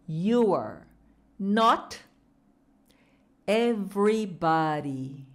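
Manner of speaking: word by word, slow and clear; slow chunks. Shown as a demonstration. Teacher drilling the mom phrase slowly. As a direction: pause before everybody and stretch it. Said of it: "Fala comigo".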